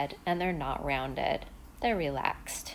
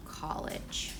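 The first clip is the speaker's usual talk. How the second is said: whispered model; 6 dB quieter than usual. Shown as a demonstration. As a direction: whisper "college." softly, no voice. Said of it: "we're going to try".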